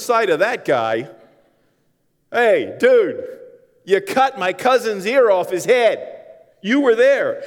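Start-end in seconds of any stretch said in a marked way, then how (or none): 2.3-7.4 loud, higher, slower confronting call; angry accusation. Acted. Relative accusing Peter of cutting the ear, loud. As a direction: call him out across the fire.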